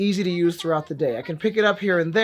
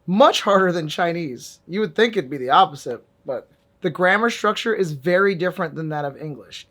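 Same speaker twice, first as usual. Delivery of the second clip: ordinary talk, nothing marked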